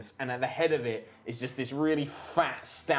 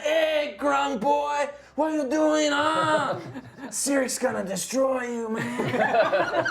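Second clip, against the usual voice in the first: high, loud jeering gang heckle; skull-faced thug; 12 semitones higher, 5 dB louder, much slower than usual. Acted. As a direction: call out a mocking threat, pitched way up. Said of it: "the skull-faced guys, like, get up and they're like".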